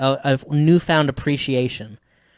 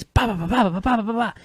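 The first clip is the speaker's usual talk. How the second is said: mock guitar riff; 8 semitones higher than usual.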